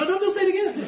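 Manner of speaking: high, laughing hammed-up panic; mock alarm. Friends to Dan, a high laughing mock-alarmed plea. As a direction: hurry to stop him, half laughing.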